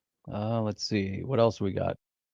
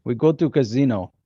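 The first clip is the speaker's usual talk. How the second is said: put-on hammy amateur Russian accent. Co-host joking about making casino money, mock Russian accent. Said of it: "Are you doing your Russian accent".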